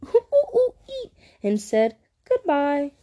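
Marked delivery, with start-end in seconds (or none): 0.0-1.1 high monkey hoots; playful "ooh ooh ee" chatter; cheeky monkey giggle. A narrator's reading. Monkeys giggled cheekily at Lion, high hooting giggle. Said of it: "The monkeys giggled cheekily".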